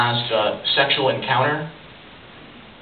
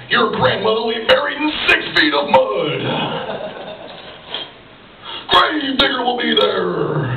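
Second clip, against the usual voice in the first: monster truck ad; 6 semitones higher, slower than usual. Retold or imitated. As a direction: pitch a funeral home like a truck rally. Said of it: "if that guy advertised, like, other stuff".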